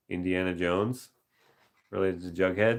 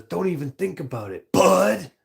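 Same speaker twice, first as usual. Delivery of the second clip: higher, with a loud lifted bud; weird inflection; 11 semitones higher, 6 dB louder than usual. Retold or imitated. Streamer imitating the odd movie line, bud popped high. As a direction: mimic the strange movie reading. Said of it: "Such a weird inflection".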